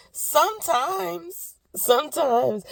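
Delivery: high, very slow and stretched out; a long, high, sheepish "sometimes"; sheepish drawn-out admission. Real, not acted. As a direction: drag the word out high, half-embarrassed. Admitting she schedules crying.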